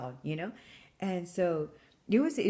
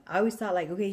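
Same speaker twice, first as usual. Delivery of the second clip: ordinary talk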